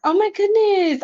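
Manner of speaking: excited exclaim. Real, not acted. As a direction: lift it up in real surprise. Thrilled at chat messages.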